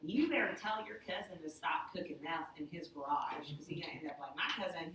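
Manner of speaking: whispered, hushed warning. Retold or imitated. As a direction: interpreter whispering a caller's meth warning. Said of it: "conversations between people, like".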